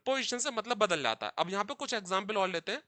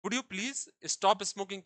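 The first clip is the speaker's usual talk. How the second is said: normal talking voice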